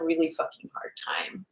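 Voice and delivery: a real, low confiding whisper; hushed aside. Real, not acted. Confiding aside about her daughter.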